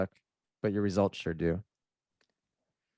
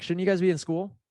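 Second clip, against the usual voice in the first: teasing; 9 semitones higher than usual. Retold or imitated. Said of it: "I'm like".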